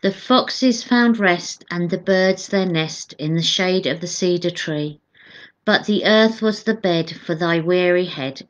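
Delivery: measured devotional verse reading; hymn read aloud. Acted.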